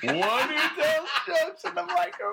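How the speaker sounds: very high, slow mock chief voice. Co-host repeating the dad's greeting, slow mock chief voice. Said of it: "That's what I hear".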